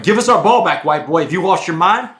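loud, high, hostile demand; angry inmates yelling. Retold or imitated. Inmates yelling at the new guy to return their ball, angry and loud.

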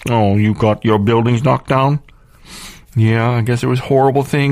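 high, sarcastic pleading voice; sarcastic mock pity; mocking sympathetic voice. Mocking the public's reaction.

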